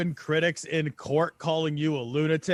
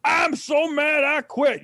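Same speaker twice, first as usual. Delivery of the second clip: slow, high mock shout; mock tantrum; 7 semitones higher, 3 dB louder, slower than usual. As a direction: play a huffy cop storming off. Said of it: "if all the police were like".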